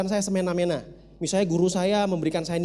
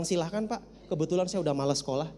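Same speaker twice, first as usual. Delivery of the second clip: nonchalant reply; 2 semitones lower, 9 dB quieter than usual. Retold or imitated. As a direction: toss it off without concern. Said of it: "Saya bilang".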